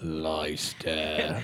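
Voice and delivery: put-on slow, low, drawn-out English accent; exaggerated old English accent, pitched low. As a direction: stretch out Leicester in a mock English accent. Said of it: "They do the accent".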